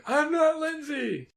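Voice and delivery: high, slow shrill wail at normal volume; high mock wail. Cohost repeats the scream as a high, slow mock wail.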